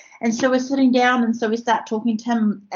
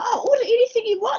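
mock generous offer; 13 semitones higher, faster than usual. Offering food in playful high voice.

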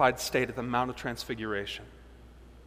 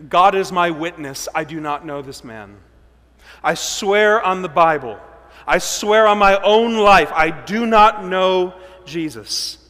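louder, slow, pleading swear; desperate oath; 3 semitones higher, 9 dB louder than usual. Acted. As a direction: stake your life on the lie. Peter swearing oaths.

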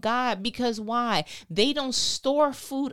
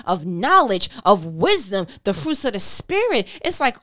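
building to a high, loud climax; impassioned preaching; 4 semitones higher, 3 dB louder than usual. Real, not acted. Preacher urges congregation toward freedom from worry, impassioned and building. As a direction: preach it with rising urgency, phrase after phrase.

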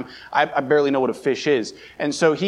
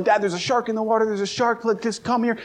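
a mock kid's alarm, rushed and pitched up; retold panicked yelling; 8 semitones higher than usual. Boy at the boat's front begging dad to look, high rushed mock panic.

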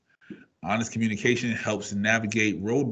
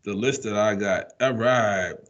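ordinary delivery

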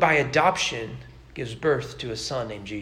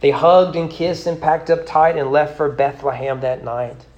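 rhythmic, lilting poem read aloud; 4 dB louder than usual. Acted. Preacher reciting a rhyming poem to his congregation, lilting. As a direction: recite the rhyme with a storyteller's swing.

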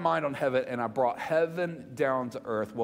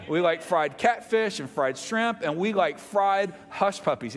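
ordinary talk

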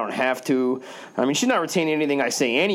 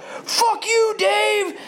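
very high tearful screaming; crying scream; much slower than usual. Retold or imitated. Drunk girl screaming after him across the courtyard, high and tearful. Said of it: "she screams out".

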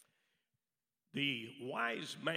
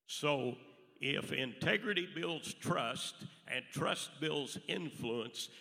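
ordinary delivery

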